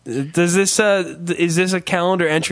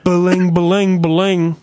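phone ring noise; 5 dB louder than usual. Pretend. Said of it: "this is you".

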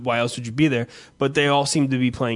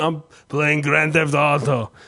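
put-on slow Russian-accented answer; imitated Russian gamer, words drawn out; slower than usual. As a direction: answer slowly as the Russian guy. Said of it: "in his Russian accent".

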